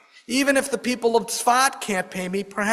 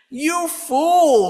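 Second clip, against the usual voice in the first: put-on a sharp, high, louder rebuke from the old rabbi; angry rabbi; 9 semitones higher, 5 dB louder than usual. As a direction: scold him as a fool, voice raised high. Rabbi scolds Jacobo in anger.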